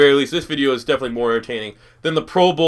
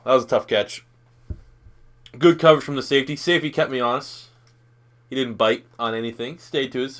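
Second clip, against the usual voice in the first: ordinary talk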